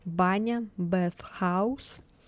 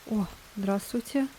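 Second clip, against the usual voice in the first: ordinary talk, nothing marked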